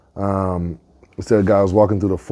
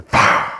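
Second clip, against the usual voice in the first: sudden explosive unvoiced "pow"; gunshot imitation. Farmer's shot cuts off the turkey, explosive gunshot noise. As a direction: burst of air as a gunshot.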